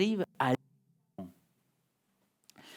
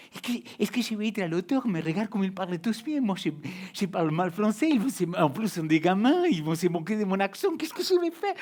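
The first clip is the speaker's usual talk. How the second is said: panique rejouée; 4 semitones higher than usual. Retold or imitated. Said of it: "J'ai dit".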